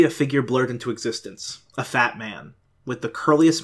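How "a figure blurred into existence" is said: ordinary delivery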